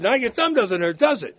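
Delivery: father taunting his hurt son; mocking dad. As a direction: needle the kid. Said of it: "He said".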